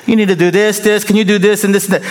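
high, fast run of demands; nagging wife. Retold or imitated. Preacher mimics his wife listing chores, a light nagging voice. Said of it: "she would say".

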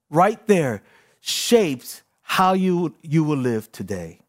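ordinary delivery

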